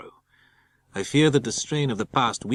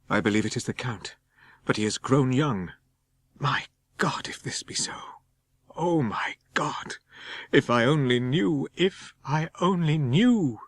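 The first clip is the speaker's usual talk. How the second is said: terrified whisper; 3 semitones higher than usual. Acted. Jonathan terrified at seeing Count.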